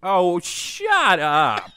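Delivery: loud, high, drawn-out retort; exasperated outburst. Real, not acted. Co-host cutting off the interrupters, exasperated outburst. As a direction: shout them down, stretching every word.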